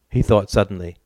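usual speaking voice

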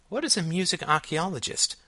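put-on high-pitched curious little girl; daughter's high questioning voice; child character. A narrator's reading. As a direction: ask it high, like a small child. Young Alice asking her father.

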